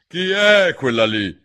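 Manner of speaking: high, loud and slow, commanding; imperious queen. Acted. La regina chiede di Alice, imperiosa. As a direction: demand it like a haughty queen. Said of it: "con voce imperiosa".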